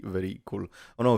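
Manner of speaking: normal talking voice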